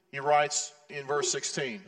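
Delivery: ordinary delivery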